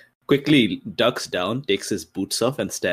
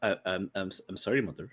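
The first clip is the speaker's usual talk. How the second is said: much softer, sheepish sorry; meek apology; 11 dB quieter than usual. Acted. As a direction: shrink before your mother. Son apologizing to angry mother.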